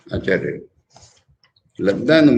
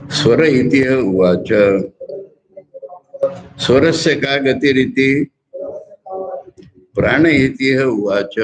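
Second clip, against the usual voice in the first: slow scriptural recitation; 2 semitones higher, slower than usual. Acted. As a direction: intone each question and answer slowly. Recites the Sanskrit dialogue.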